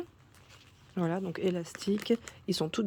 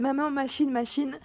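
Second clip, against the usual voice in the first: lightly voiced little girl, pitched up; imitating eager daughter; 6 semitones higher than usual. Daughter asking mum to run the wash, lightly imitated eagerness. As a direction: mimic a child pestering mum. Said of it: "elle me disait".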